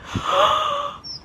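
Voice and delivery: high, near-sobbing real gasp; teary gasp. Real, not acted. Overcome by the asparagus, a teary real gasp. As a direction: gasp as if about to cry. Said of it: "I'm actually shaking".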